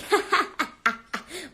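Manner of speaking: short bouncy cackle at own joke; ha-ha-ha, pleased with the joke; laughing. Acted. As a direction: laugh at your own gag.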